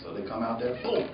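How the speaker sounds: normal talking voice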